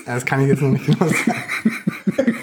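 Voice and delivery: words breaking into real laughter; amused, laughing. Real, not acted. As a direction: answer through a laugh. Joking about the promotion party date. (lachend gesprochen)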